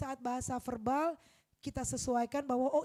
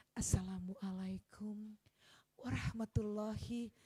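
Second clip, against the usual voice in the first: salam lambat, rendah, dan lirih ala ibu dosen; dosen sok elegan; 5 semitones lower, 6 dB quieter, slower than usual. Retold or imitated. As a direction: tirukan ibu dosen yang ingin terlihat anggun. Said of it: "ibu-ibu dosen ya kan pengen kelihatan elegan". (ibu dosen memberi salam dengan gaya sok elegan dan lambat)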